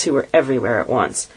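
ordinary delivery

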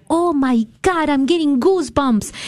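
high, slow, marvelling quote of the doctor; amazed doctor. Retold or imitated. Doctor reacts to the patient's fish story, amazed.

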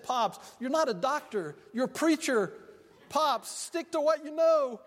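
a preacher playing his sassy granddaughter; granddaughter's retort.

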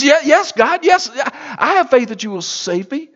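high, stumbling eager answer; tripping over the words to agree; a frightened man answering God; stammering yes. Acted.

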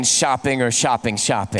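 husband echoing the wife's doubled "shopping"; double word stress. Retold or imitated. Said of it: "What does that mean".

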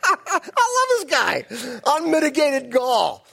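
high, slow, loud delight, laughing; tickled by the sheer nerve; amused outburst. Real, not acted. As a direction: crow it through real laughter. Amused by Saul's self-made monument.

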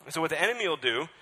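fast, loud sermon build-up; fiery preaching. Acted. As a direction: drive the point at the congregation.

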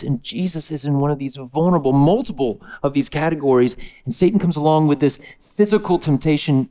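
ordinary talk

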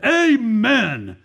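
high, drawn-out mock amen call; acted congregational amen. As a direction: imitate an approving crowd calling out, pitch swooping up. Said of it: "They said three amens".

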